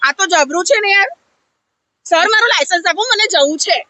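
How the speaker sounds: normal talking voice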